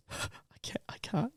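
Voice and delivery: breathy retold whisper, barely voiced. Girl on the show whispering to a friend, hushed. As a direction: whisper it like she can't get the words out. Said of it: "She goes".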